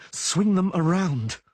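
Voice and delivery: slow, loud effortful order; strained instruction. Acted. Geralt tells Yennefer to swing her legs, slow strained order. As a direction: grit it out while hanging.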